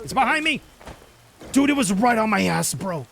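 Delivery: frantic, high and loud; breathless panic on the run; being chased. Real, not acted. Fleeing the saber cat.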